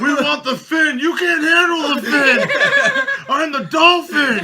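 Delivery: shouting at the top of his lungs in court; courtroom outburst. Pretend. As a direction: yell it like a defendant losing control.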